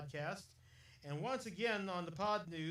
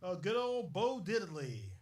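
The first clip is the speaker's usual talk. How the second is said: normal talking voice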